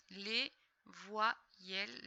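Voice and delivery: "Les voyelles" very slow, broken into syllables; syllable-by-syllable reading. Shown as a demonstration. Teacher decoding for a pupil, "Les voyelles" syllable by syllable. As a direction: pause after each syllable of "Les voyelles". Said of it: "on les lit ensemble".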